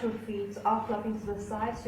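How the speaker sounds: ordinary talk, nothing marked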